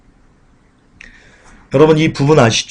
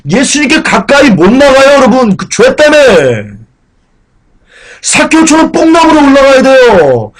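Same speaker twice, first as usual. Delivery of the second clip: high, loud pulpit fervor; impassioned preaching; 8 semitones higher, 4 dB louder than usual. Acted. Pastor urging the congregation, fervent preaching. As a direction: preach it hard to the pews.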